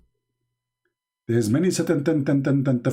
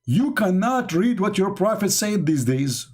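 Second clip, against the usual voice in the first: mock scolding; 6 semitones higher than usual. Mock scolding of upset Muslims.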